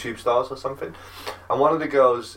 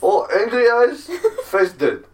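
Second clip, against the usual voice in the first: slow, higher deadpan Russian accent; 13 semitones higher, 5 dB louder, slower than usual. Shown as a demonstration. S0 demonstrates the dead-face Russian voice to friend, deadpan Russian accent. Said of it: "It's like you changed your person".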